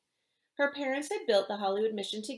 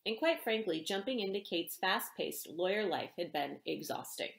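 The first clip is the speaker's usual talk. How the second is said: ordinary delivery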